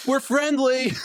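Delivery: high, loud reassuring shout; yelling. Acted. Bursting through the door to the crew inside, yelling loud and high. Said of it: "I'm gonna yell".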